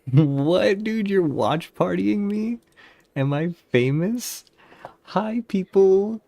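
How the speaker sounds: high, slow, excited squeal; thrilled to hear people are watching; delighted surprise. Real, not acted. Streamer greeting the watch party, high and slow with excitement.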